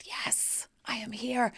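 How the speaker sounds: diva whisper. Retold or imitated. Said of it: "and I said".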